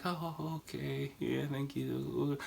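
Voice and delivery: high, soft deferential thanks; bow your way out with hushed politeness; polite backing away. Shown as a demonstration. Bowing out of an awkward situation, polite backing away. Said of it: "Do a few bows".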